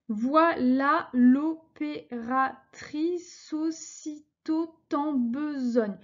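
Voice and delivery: slow, syllable by syllable verse reading; scanning syllables slowly. Shown as a demonstration. Teacher scanning a verse for her class, slow and measured. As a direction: count out each syllable of the line as you read. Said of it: "découpé les syllabes".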